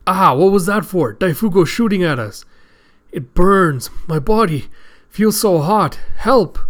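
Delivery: high, loud acted cries; panicked pain. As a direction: cry out as if burning, begging for help. Prisoner shot, burning in pain.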